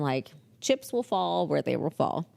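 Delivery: ordinary talk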